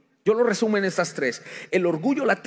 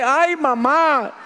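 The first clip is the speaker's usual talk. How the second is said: lamento del corazón; 8 semitones higher, 5 dB louder, much slower than usual. Acted. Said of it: "Y el corazón dice".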